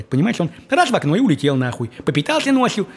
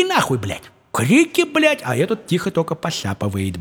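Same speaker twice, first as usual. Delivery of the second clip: ordinary talk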